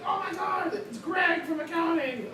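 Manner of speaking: high, startled kobold cry of recognition; a kobold shocked to spot a coworker; surprised kobold. Retold or imitated. Kobold recognizing the disguised party member, high surprised cry. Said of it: "kobold was like".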